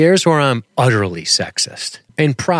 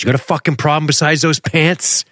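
higher, aggressive mock mobster challenge; Tony Soprano; 7 semitones higher than usual. Imitating Tony charging the Russian on the boat, aggressive. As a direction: confront him in an imitation of an angry mobster. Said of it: "like, hard Tony Soprano charge walks him".

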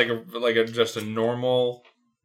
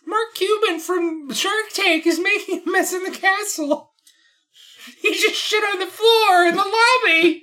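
high squeaky Toad voice; 6 dB louder than usual. Acted. Imitating Toad voice.

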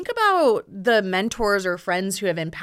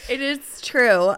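laughing through the words; 9 semitones higher, 5 dB louder, slower than usual. Real, not acted. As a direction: laugh hard while talking. Laughing about mirroring.

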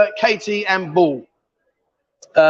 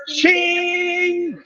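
cash register ka-ching; 9 semitones higher, 3 dB quieter than usual. Pretend. Dowry talk, cash register sound.

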